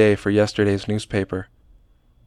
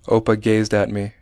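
usual speaking voice

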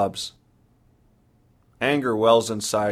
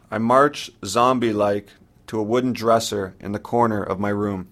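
usual speaking voice

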